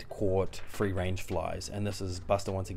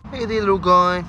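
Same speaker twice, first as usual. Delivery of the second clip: put-on squeaky baby talk, much higher and louder; high cutesy voice; 12 semitones higher, 14 dB louder than usual. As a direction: coo at the little hedgehog in a tiny squeal. High voice greeting hedgehog.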